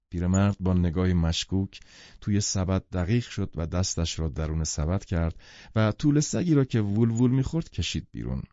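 ordinary delivery, nothing marked

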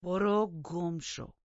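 slow, loud, hostile dismissal; surly growled rebuff. A narrator's reading. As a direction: growl it with full hostility, drawn out. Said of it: "کاملاً خصمانه غرید".

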